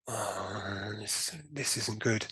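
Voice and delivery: retelling a sinking realisation, drawn out; dismayed. Retold or imitated. Patient retelling his stoma stopping, dismayed.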